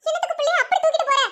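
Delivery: worried grandmother protesting; alarmed protest. Acted. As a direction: call after her in dismay. Grandmother protests after mother grabs baby.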